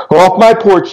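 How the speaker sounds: scared homeowner yelling a threat. A narrator's reading. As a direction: order her off the porch. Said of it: "he yelled".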